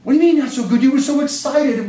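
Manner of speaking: incredulous, puzzled teacher; teacher puzzled by the boy's gloom; surprised teacher. Retold or imitated.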